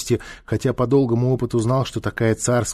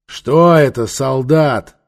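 loud, raised demand; 3 semitones higher, 7 dB louder, much slower than usual. Acted. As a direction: question a subordinate sharply. Ruler questions his soldier.

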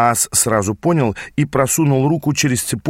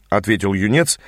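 plain reading voice

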